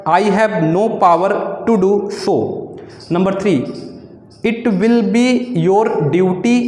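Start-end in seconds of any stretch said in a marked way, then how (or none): 0.1-2.4 slow dictation. Shown as a demonstration. Dictating shorthand exercise. 4.5-6.6 slow dictation. Shown as a demonstration. Dictating shorthand exercise.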